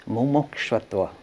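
usual speaking voice

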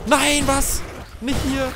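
high loud panicked cry; panicking gamer. Real, not acted. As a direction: panic as you're trapped. Caught by enemies in game.

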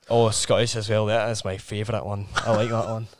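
put-on low, soft Scottish accent. Friend showing off a favourite, relaxed Scottish accent. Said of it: "like, Scottish".

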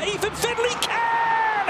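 high, slow call on can; long goal yell. Real, not acted. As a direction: land the answer hard.

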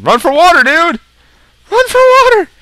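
high, loud shouted warning; mock-urgent yelling. Player calling to the burning pig, mock-urgent yelling.

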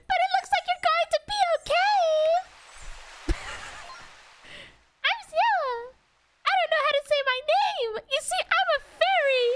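high, irritating fairy; annoying fairy voice. Acted. Fairy greeting someone who just woke, annoying high voice. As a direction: pipe up high as a pesky fairy. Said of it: "It has to be an annoying voice".

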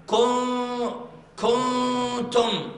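usual speaking voice

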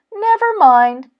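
high, loud, reassuring call; upbeat storybook Frog; consoling Frog. Acted. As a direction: brush off the loss as Frog.

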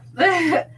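short burst of real laughter. Real, not acted. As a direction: laugh breaks through the reading. Narrator breaks up laughing.

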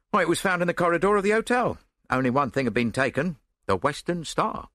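a hint of a busy police officer; Scotland Yard man. A narrator's reading. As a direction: report the facts as a policeman.

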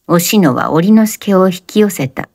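ordinary delivery, nothing marked

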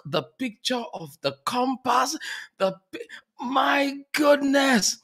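high, tearful awe; overwhelmed, emotional. Real, not acted. Host raving about his full pages of notes, overwhelmed.

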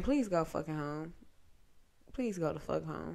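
under-breath begging; quiet pleading. Retold or imitated. Inner thought, pleading.

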